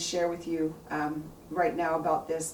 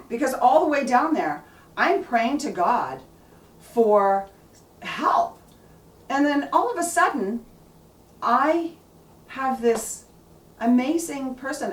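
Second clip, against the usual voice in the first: excited; 5 semitones higher, 5 dB louder than usual. Real, not acted. Prayer answered by a stranger.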